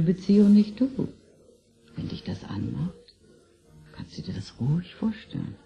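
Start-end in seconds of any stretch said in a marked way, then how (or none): 2.0-5.6 a teasing come-on, all in whisper; whispered seduction in his ear; seductive whisper. Acted. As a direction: whisper the invitation intimately. Teasing him about his fantasy.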